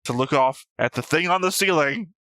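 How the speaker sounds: usual speaking voice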